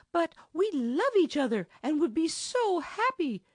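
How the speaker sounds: high, tearful and pleading; on the edge of tears, pitched high; tearful plea. A narrator's reading. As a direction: near tears, begging him. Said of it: "while tears filled her pretty eyes".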